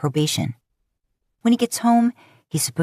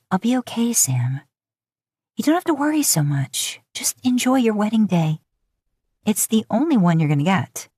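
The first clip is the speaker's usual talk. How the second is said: higher, gentle reassurance; reassuring narrator heroine; 5 semitones higher than usual. Acted. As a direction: soothe him, lightly and kindly. You reassure Sam at wedding.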